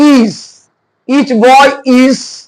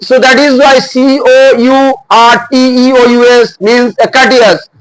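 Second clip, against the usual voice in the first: normal talking voice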